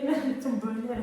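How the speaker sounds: usual speaking voice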